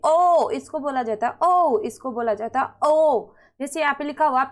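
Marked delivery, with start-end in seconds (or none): 0.1-0.5 ओ held long and round. Shown as a demonstration. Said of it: "इसकी ध्वनि long निकलती है". 1.4-1.8 ओ held long and round. Shown as a demonstration. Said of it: "इसकी ध्वनि long निकलती है". 2.8-3.3 ओ held long and round. Shown as a demonstration. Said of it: "इसकी ध्वनि लॉन्ग निकलती है".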